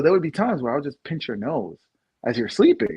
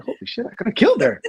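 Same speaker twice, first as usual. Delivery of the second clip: retold shock; 9 semitones higher, faster than usual. Retold realization, high fast.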